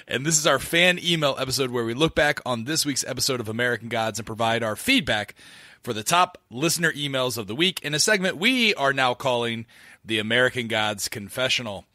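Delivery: usual speaking voice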